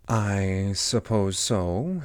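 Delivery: very slow, hesitant agreement; doubtful Alice. Acted. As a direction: concede reluctantly, stretching each word. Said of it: "said Alice".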